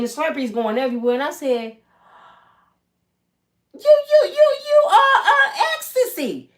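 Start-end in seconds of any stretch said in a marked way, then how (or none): 3.7-6.5 high, loud stammering shout; starstruck shout. Retold or imitated. The girl spotting [name] at the Slurpee machine, starstruck shrieking.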